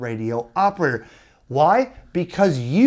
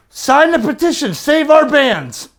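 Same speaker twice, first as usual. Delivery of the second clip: higher, louder hammed-up rallying cry; overdone fake outrage, pitched up; mock alarmist plea; 10 semitones higher, 8 dB louder than usual. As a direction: parody a panicked campaign call, last words slowed. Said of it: "make a YouTube video and say".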